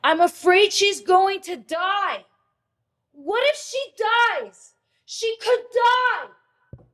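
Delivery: high, slow, loud terrified scream; screamed in fear. Retold or imitated. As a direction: scream it out the door, desperate. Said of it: "and I just screamed".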